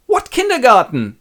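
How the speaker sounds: father shouting at his youngest; outraged king. Acted. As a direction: explode at the daughter's cheek. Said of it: "Lear angry at Cordelia".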